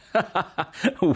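high, open belly laugh; hearty laugh. Acted. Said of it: "Jack rideva così di cuore".

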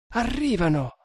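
high-pitched alarmed warning; anguished. A narrator's reading. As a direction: warn in anguish, voice jumping up. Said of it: "disse lei con angoscia". (allarme angosciato, voce acuta)

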